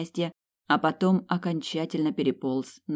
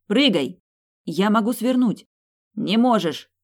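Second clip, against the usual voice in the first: loud, high urgent shouts; short urgent cries between moving vehicles; calling out; 6 semitones higher, 6 dB louder than usual. A narrator's reading. Lovers shout from car to drone, urgent and loud.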